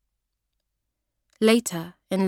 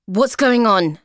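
loud, higher demanding snap; angry question; 4 semitones higher, 9 dB louder, slower than usual. Acted. Kathy confronting Rachel at her bedroom door, angry. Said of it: "she asks angrily".

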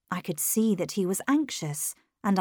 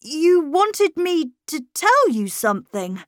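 high, louder, slow and bright; 9 semitones higher, 6 dB louder than usual. A narrator's reading. Miles answering the governess, very brightly. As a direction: brightly spell it out, pitched up. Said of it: "very brightly".

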